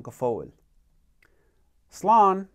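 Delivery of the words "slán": loud, high model word; leading a drill. Shown as a demonstration. Teacher giving a goodbye for learners to answer, loud cue. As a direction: call it out high and loud for practice. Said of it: "I'm gonna practice saying slán".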